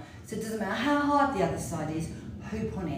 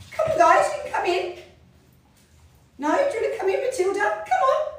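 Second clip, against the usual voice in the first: high, louder sing-song calling; calling the dogs; 8 dB louder than usual. Real, not acted. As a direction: coax the dogs in, high and bright.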